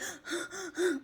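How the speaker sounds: sharp high panting sigh. Shown as a demonstration. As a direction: show an upset person's wrong breath. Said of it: "you tell them to deep breathe, they".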